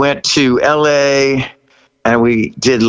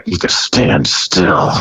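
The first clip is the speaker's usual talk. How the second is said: lower, slow, hushed aside; calm host aside; 7 semitones lower, slower than usual. Retold or imitated. Dick Clark calming the hyper singer, low and slow. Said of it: "kinda go".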